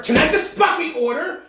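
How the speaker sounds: mock the entitled caller losing it; hammed-up rude customer outburst; snapping customer.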